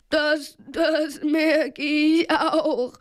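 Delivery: slow, stuttering tremble; trembling, drawn-out fright; shivering with fear. A narrator's reading. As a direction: quaver the words out, teeth chattering. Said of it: "bibberte Peter".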